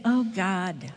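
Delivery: subdued, halting prayer for help; weary plea. Retold or imitated. Woman confessing to God that she needs help, weary plea. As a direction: plead wearily, with long pauses.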